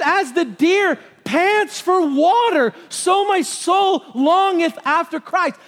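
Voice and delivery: high, slow, emphatic cry; forceful longing. Acted. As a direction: preach it with urgent, drawn-out yearning. Corrects the mamby-pamby reading with force.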